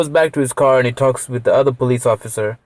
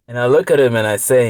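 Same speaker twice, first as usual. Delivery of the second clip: normal talking voice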